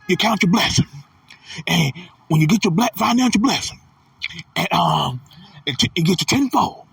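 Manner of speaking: mock a preacher in a high-pitched, halting pulpit voice. Mocking how a preacher talks about blessings and tenfold, high-pitched preacher impression. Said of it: "that's how T D J talk".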